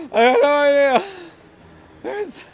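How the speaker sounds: loud, high words out of a laughing fit; laughing outburst. Real, not acted. Player loses it over spoiler joke, laughing outburst. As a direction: blurt it loudly while cracking up.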